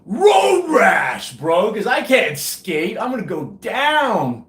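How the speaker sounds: high, loud hammed-up wail; mock panicked yell. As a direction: play-act a crash with loud, drawn-out cries. Imagines himself crashing on skates.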